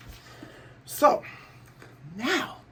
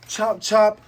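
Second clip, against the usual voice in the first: higher, louder "Chop, chop"; attack call; 8 semitones higher, 6 dB louder than usual. Retold or imitated. Buggy launching his chop attack, higher and louder. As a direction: bark it like an attack name.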